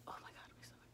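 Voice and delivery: hushed groaning whisper of nausea; breathy oh-my-God, stomach aching; pained whisper. Retold or imitated. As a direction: whisper it clutching a sick stomach. Retold sudden stomach pain.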